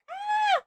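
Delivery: shrill yelp of pain at the noise; high startled yelp. Real, not acted. Ads too loud in headphones.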